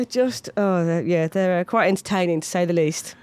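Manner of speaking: ordinary delivery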